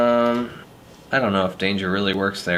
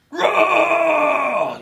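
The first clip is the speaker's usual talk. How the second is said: screamed roar, much higher and louder; monster roar; 4 dB louder than usual. Acted. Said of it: "warm up those screaming pipes".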